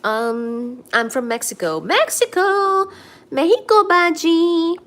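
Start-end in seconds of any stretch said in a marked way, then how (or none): 1.8-4.8 high, loud cheer; excited greeting. Real, not acted. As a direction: cheer the viewer's country.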